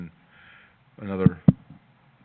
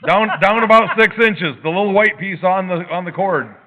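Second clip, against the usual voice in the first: raised, higher-pitched voice, easing toward the end; calling out directions; 7 semitones higher, 8 dB louder than usual. Real, not acted. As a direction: call it out loudly to someone on a bad connection. Calling directions to remote member.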